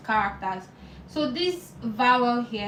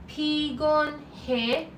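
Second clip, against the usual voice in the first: PIGON HE said very slowly, higher pitched; slow, raised teaching demo of pigon he; syllable by syllable; 4 semitones higher, much slower than usual. Shown as a demonstration. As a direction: draw out each syllable of pigon he. Teacher breaking word into syllables.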